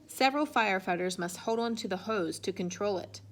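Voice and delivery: ordinary delivery, nothing marked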